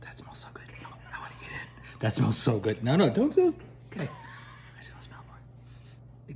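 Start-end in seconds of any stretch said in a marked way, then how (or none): 0.0-2.0 greedy little whisper. Real, not acted. Whispering about the lip juicer smell. 4.1-6.4 slow yearning whisper. Real, not acted. Whispering while the product is taken away.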